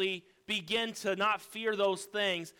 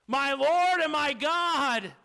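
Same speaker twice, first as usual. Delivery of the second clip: acted cry of recognition, slow and raised; awed exclamation; 8 semitones higher, 8 dB louder, slower than usual. Thomas seeing the risen Jesus, exclaiming in awe. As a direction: cry it out, drawing out each word.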